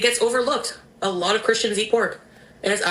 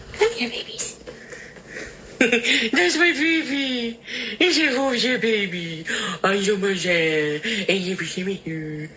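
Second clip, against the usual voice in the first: overwhelmed by cuteness; slower than usual. Real, not acted. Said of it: "Sorry, cute aggression".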